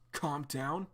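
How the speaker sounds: Arlo holding John back, gently pleading; soothing calm down. Acted.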